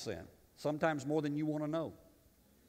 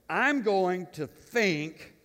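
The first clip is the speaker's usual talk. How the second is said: slow teasing build; 4 semitones higher, 4 dB louder, much slower than usual. Real, not acted.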